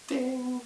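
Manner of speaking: sound-effect ding. Acted. Jupiter answers the prayer.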